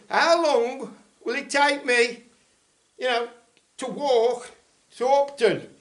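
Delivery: slow, loud, spelled-out question; overly careful slow question for a simpleton; slow and deliberate. Retold or imitated. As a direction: talk down to him as if he were simple. Dumbing it down for the yokel.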